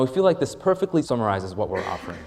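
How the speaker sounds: ordinary talk, nothing marked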